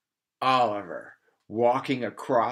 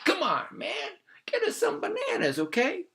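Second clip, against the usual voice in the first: very high, wheedling plea; high pleading. Acted. Monkey begs his friends for bananas, very high. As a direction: beg for bananas in a squeaky voice.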